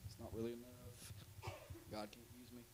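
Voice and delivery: very low, tiny-volume mumble; meek, defeated little voice; timid self-doubt. Acted. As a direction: shrink away, barely audible. Voicing people timid about their calling.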